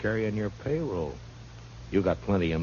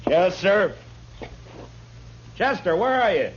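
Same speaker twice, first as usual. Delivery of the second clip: calling out; 10 semitones higher than usual. Acted. Marshal calling out to find his deputy Chester. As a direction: call out for your deputy from another room.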